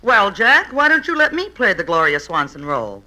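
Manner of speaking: usual speaking voice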